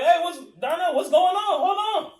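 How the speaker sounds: high, loud call to break it up; alarmed calling out. Retold or imitated. Friend calling out to Donnell to hold on, high and loud. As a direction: call out to stop the fight. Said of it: "I'm gonna be like".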